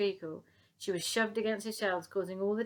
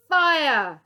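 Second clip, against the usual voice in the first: loud, high command; shout; 7 semitones higher, 16 dB louder than usual. Acted. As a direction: yell the order. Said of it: "shouted Titch".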